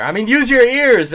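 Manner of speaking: high, loud mic-test shout; exasperated mock yell; sudden loud outburst. Shown as a demonstration. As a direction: yell it high to make the listener notice. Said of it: "Am I getting louder or quieter?".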